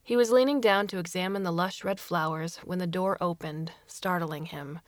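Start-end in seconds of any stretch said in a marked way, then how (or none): none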